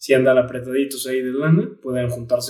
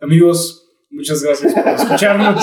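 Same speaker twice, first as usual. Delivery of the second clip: laughing; 3 semitones higher, 5 dB louder than usual. Real, not acted. Sign-off laughing.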